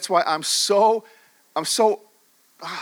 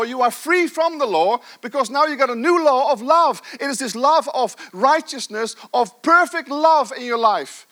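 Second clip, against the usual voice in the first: higher, louder full-force preaching; fiery preaching; 7 semitones higher, 5 dB louder than usual. Acted. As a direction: preach it hard to the crowd.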